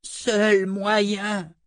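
high, drawn-out wizard croak. A narrator's reading. Trapped wizard naming the only way out, croaking. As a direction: croak the words out with effort. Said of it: "croassa le sorcier".

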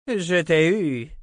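drawn-out, high and loud gloat; triumphant. A narrator's reading. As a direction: crow it slowly in triumph. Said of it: "triompha l'autre sœur".

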